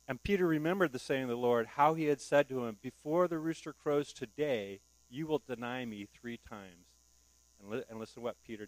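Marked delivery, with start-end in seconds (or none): 0.1-6.9 loud, slowing toward the end; fervent scripture reading; preaching scripture. Acted. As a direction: build the reading to the prophecy. Scripture read aloud, rising intensity.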